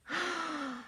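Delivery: soft surprised gasp; a quick quiet intake of breath. A narrator's reading. Listener reacts to the news.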